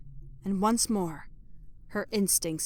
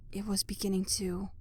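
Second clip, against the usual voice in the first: softer whisper trailing off; fading hush; 7 dB quieter than usual. A narrator's reading. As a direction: let the voice wilt away. Narrator fades on wilt.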